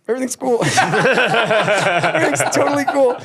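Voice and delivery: laughing through a fake cool to the cop; nervous denial. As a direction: laugh it off while clearly guilty. Denying breathalyzer to cop.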